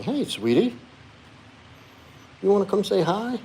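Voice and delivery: high, sweet coaxing; coaxing pet talk. Real, not acted. Coaxing the dog over.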